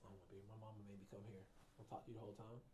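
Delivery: a hint of a sullen teen patient, quick and quiet. Patient forced to come by mama, sullen and low-key. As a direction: mutter it like a kid dragged in by mom. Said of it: "use silence as a weapon".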